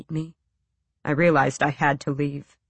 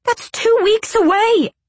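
high, loud alarmed cry; shocked outburst; 6 dB louder than usual. A narrator's reading. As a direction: burst out in dismay. Outburst at his plan.

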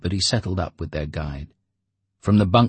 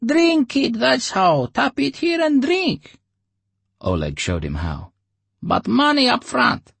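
very high, louder put-on voice; dealer Oleg; 5 dB louder than usual. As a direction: explain it like a shady dealer. Oleg instructs.

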